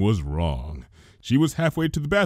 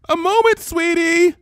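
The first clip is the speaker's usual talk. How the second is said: high, louder call down the hall; 5 dB louder than usual. Acted. Rarity calls back to her sister, raised call. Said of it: "she called".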